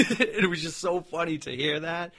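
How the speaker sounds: real amused laughter while speaking; laughing. Real, not acted. Laughing at Dave's reaction.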